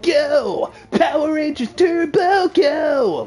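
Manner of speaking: "Go, Power Rangers Turbo, go!" shouted high with full enthusiasm; enthusiastic. Shown as a demonstration. Gamer shows how the theme should sound, high, enthusiastic shout. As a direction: hype it up like the real theme song. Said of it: "it's got more enthusiasm than".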